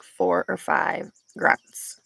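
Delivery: normal talking voice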